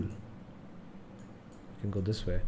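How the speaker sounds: slow breathy whisper to himself; whispered aside. Real, not acted. Streamer whispering his next move to himself. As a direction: murmur the route under his breath.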